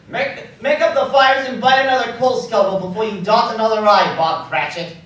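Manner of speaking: booming, jolly orders to Bob; a big-hearted holler at the clerk; hearty loud command. Acted. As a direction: shout the good news across the office. Scrooge joyfully ordering more fire.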